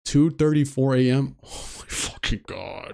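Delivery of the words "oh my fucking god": whispered curse. Real, not acted. Spooked by 2:34 AM call.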